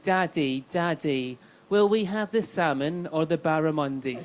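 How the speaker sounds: put-on slow, sing-song whiny kid voice; mock the spoiled son asking his dad; lightly voiced pampered boy; posh little son. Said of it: "The son would be like".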